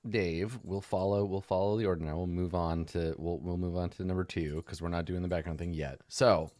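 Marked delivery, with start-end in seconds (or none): none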